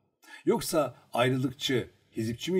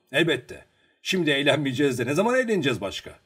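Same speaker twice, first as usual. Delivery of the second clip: loud, fast, raised-voice reply; lively, emphatic character answer; hearty agreement; 4 semitones higher, 8 dB louder, faster than usual. A narrator's reading. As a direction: agree with gusto, rushing the words out. Hearty agreement in acted dialogue.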